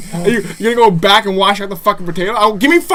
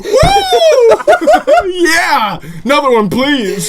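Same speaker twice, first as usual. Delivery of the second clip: mock cheering; 10 semitones higher, 4 dB louder, slower than usual. Acting granny dropping soup bowls.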